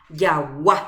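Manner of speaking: Jagua with a loud final A; stress on final A. Shown as a demonstration. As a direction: push the last A of "Jagua" hard. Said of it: "Ja'e A ári jajepa". ("Jagua" con la A final más fuerte)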